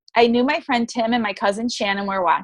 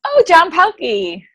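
choked recall; 7 semitones higher, 5 dB louder, slower than usual. Real, not acted. Remembering with emotion.

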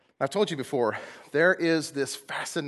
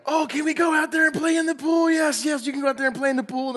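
put-on high, loud begging child voice; excited kid; 14 semitones higher, 4 dB louder than usual. Pastor voicing a kid begging to play in the pool, high and eager. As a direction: squeal it like an eager little kid.

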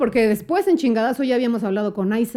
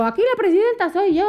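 higher-pitched declaration of authority; mock assertive; 8 semitones higher than usual. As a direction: put your foot down, pitched up. Retelling facing down a pushy guest. (autoritaria, en tono agudo)

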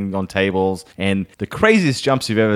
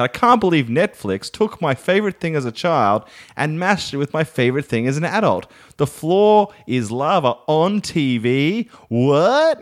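higher, slowed "The floor is lava on T V. What?"; charming hammed-up read; 3 semitones higher than usual. Shown as a demonstration. Reading his tweet aloud, hammed-up and delighted. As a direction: sell the tweet with wide-eyed delight. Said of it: "if you say it like I said".

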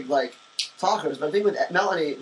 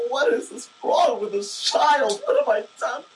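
high, slow dismayed parent; despairing mom wondering what went wrong; exasperated parent; 7 semitones higher, slower than usual. Retold or imitated. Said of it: "My family's like".

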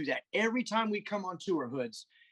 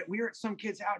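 ordinary talk

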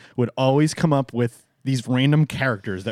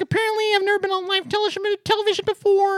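put-on very high, fast, squeaky kid voice; viral kid impression; faster than usual. Co-host quoting the redheaded kid from Ellen, high and rushed. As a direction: rattle it off like an excited little boy. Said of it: "Remember the kid was like".

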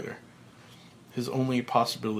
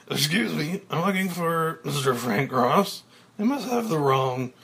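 confused Elliot voice; 5 semitones higher, 5 dB louder than usual. Acted.

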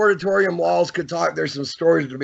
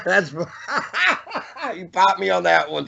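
laughing, higher-pitched delivery; cracking up; 4 semitones higher than usual. Real, not acted. Host cracking up at the guest's dirty joke, laughing. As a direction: talk through real laughter.

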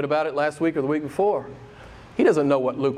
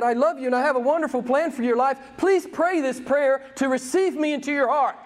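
mock sales pitch; 8 semitones higher than usual. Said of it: "Jesus turned around and he said".

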